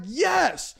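loud, high whooping yell; excited cheering. Acted. Guys in the back cheer on comedy, excited yelling. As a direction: cheer like hecklers from the back, stretching the last words.

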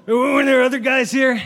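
high-pitched, indignant demand; angry dad. Acted. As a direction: interrogate like an irate neighbor. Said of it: "And he goes".

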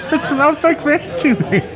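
high laughing words. Real, not acted. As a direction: laugh through the line. Laughing while describing the plate.

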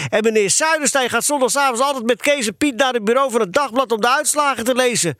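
put-on high-pitched, agitated boy's tirade; worked-up cry. A narrator's reading. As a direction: argue on excitedly as an aggrieved boy. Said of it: "riep Eddy".

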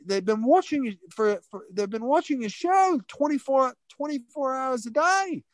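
high-pitched, excited Australian bloke; broad Australian impression, high and eager; Australian accent. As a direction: imitate a gushing Aussie, pitched well up. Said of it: "I'll stop with the accent".

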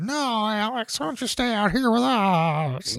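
high, slow put-on old lady voice; mock old ladies. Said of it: "you heard".

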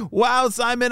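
ordinary delivery, nothing marked

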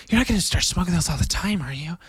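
high, fast put-on girlfriend voice; imitating Kylie. Mocking his girlfriend Kylie questioning his cigar habit. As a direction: mimic the suspicious girlfriend. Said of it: "Kylie was immediately like".

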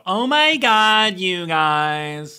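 put-on high, loud, drawn-out Valley Girl accent; mock Valley Girl gushing. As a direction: stretch it out like a caricature Valley Girl. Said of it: "the Valley Girl thing".

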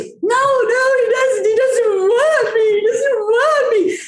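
high, slow, wailing sob-voice; imitating her crying. Host imitates a crying castmate: high tearful wail. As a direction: mimic a tearful girl insisting he doesn't care. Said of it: "crying her eyes out".

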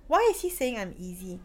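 ordinary delivery

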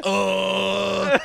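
high, crying little oh; mock whimper. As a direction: fake a deflated whine. Defeated friend, mock whimper.

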